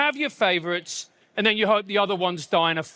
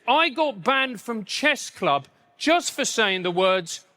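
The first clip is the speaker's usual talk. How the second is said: usual speaking voice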